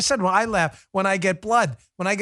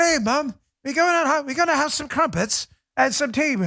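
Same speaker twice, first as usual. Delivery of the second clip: high, badly faked British child; hammed-up kid's British accent; mock British accent; 5 semitones higher than usual. Kid telling mom about crumpets, high mock British accent. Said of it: "now speaking in British accents".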